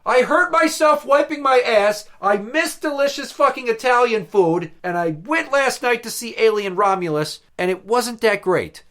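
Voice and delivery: high, loud comic lament; hammed-up aggrieved tirade; exasperated rant. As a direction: wail out a list of misfortunes. Ranting over pile of misfortunes.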